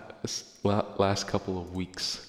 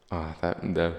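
ordinary talk, nothing marked

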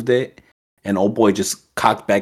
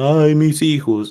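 wailing ghost; 7 semitones higher, 3 dB louder, slower than usual. Retold or imitated. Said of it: "talking about".

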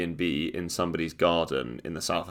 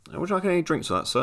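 higher polite cabin crew voice; flight attendant; 11 semitones higher, much faster than usual. Retold or imitated. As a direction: offer drinks politely. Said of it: "you sort of look".

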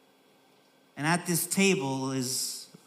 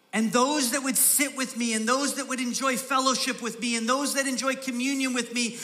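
raised, ringing pulpit voice; full-force preaching; 8 semitones higher, 4 dB louder than usual. Acted. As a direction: proclaim it to the whole church.

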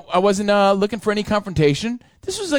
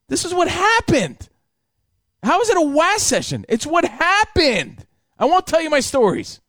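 indignant protest; 11 semitones higher than usual. Real, not acted. Indignant defense against wah session jab.

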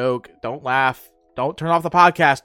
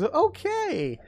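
very high, softer cartoonish okay; squeaky okay; lucky guy agreeing; 12 semitones higher, 4 dB quieter than usual. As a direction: chirp a tiny high okay. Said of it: "He goes".

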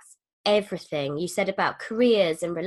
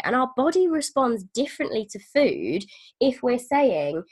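normal talking voice

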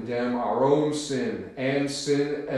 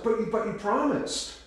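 shocked protest; 9 semitones higher than usual. Retold or imitated. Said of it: "And Boudreaux's like".

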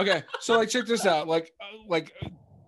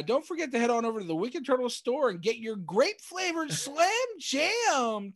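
higher pitched joke commercial voice; mock ad read; 7 semitones higher than usual. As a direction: pitch the t-shirt like a goofy ad. Mock ad plug for the store.

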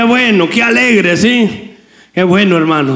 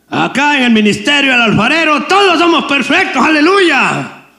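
ironic boast; 8 semitones higher than usual. Retold or imitated. Ironic boast about perfect members.

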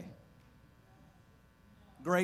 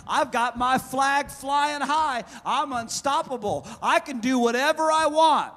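snotty, cocky boasting; 9 semitones higher, 8 dB louder than usual. Acted. Preacher mimicking the enemy boasting over states, cocky boasting. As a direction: crow it out, mocking the boaster. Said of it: "He's got his snotty, cocky thing".

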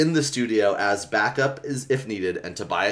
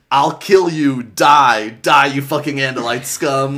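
loud, raised threat; murderous yell; 4 semitones higher, 9 dB louder, slower than usual. Retold or imitated. Imitating Tennant strangling a poodle, murderous yell. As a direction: hammer each word in a retold rage.

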